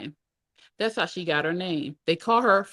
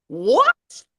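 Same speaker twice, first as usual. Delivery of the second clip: high, loud shocked yell; 13 semitones higher, 8 dB louder than usual. A narrator's reading. Grandmother learning of her daughter's pregnancy, yelling. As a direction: explode in disbelief, high and loud. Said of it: "my grandmother yelled".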